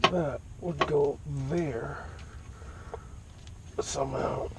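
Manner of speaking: strained, slow talk from under a car; talking on your back under a car; lying under a car. Real, not acted. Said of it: "So I'm under the car".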